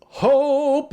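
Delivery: high, loud "Hope" with a stretched long O. Acted. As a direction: sing out "Hope" like the letter's triumph. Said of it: "and the O says".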